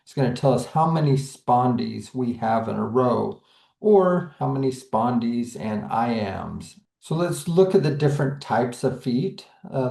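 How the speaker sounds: ordinary talk, nothing marked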